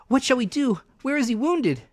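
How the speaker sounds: high, loud anxious plea; calling for help; cried out. A narrator's reading. Mother and boy cry out to the doctor, high and loud.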